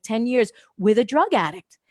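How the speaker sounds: ordinary talk, nothing marked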